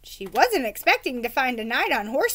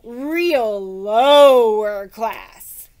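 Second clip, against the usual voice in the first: snooty drawl; 9 dB louder, much slower than usual. Acted. Woman snooty aside.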